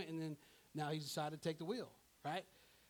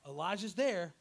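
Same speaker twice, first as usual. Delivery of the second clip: ordinary talk, nothing marked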